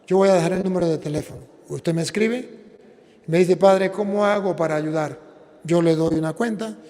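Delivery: ordinary delivery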